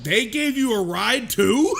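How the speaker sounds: loud, high, drawn-out disbelief; incredulous. Retold or imitated. Telling how friend was driven home by police, incredulous. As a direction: stretch it out in loud, astonished disbelief.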